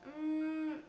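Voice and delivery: ordinary delivery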